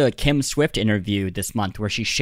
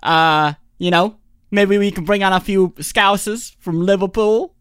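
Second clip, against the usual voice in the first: hammed-up English accent, high and loud; cheerful caricature of a Brit; British accent; 5 semitones higher, 5 dB louder than usual. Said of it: "Is that what this is like?".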